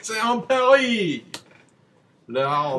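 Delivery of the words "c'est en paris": put-on French accent. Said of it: "French accent the whole time".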